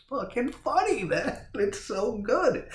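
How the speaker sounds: real giggles breaking through the words; laughing. Real, not acted. Reviewer cracking up over a funny scene, laughing.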